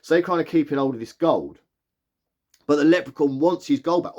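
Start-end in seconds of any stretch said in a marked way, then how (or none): none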